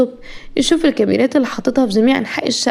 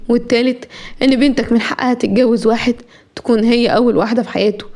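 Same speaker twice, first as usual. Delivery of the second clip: ordinary delivery, nothing marked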